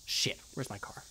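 hushed unvoiced whisper. Real, not acted. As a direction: whisper so nothing hears you. Lost, hiding from monster.

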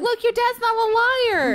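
high, loud mock comfort; mock reassurance. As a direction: chirp it like a cheesy hero. Mocking the game's reassuring line.

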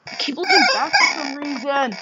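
ordinary talk

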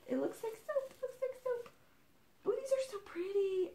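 lightly squeaky teasing voice; squeaky mock voice. Real, not acted. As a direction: say it in a tiny cutesy voice. Playful squeaky repeat.

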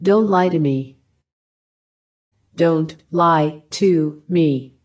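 usual speaking voice

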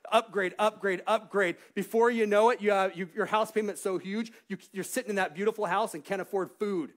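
loud, high, emphatic warning; rousing sermon warning, pitched high; full-force preaching. Real, not acted. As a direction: hammer it home to the congregation.

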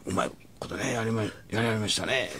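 soft, hesitant mutter; sheepish quip. Retold or imitated. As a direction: mumble it awkwardly to the boss. Retold quip to manager, sheepish.

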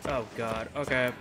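ordinary delivery

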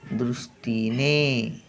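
दृष्टीने said softly with a half श before टी; soft model word. Shown as a demonstration. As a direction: model दृष्टीने gently for the class. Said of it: "थोडासा श".

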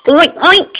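pig oink. Shown as a demonstration. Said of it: "What's a pig say?".

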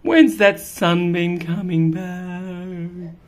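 slow mildly wistful cat; a touch of longing; slow wistful wondering. Acted.

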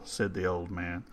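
ordinary delivery, nothing marked

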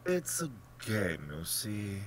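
mild, lilting matter-of-factness; pleasantly untroubled explanation; bland. A narrator's reading. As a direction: explain it blandly, lifting the first words. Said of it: "pursued the general blandly".

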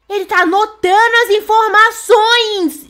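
high gasp, then slow loud words; shocked discovery. Real, not acted. Shocked by what he does.

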